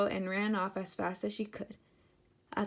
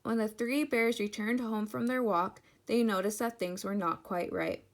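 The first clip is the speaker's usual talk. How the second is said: ordinary delivery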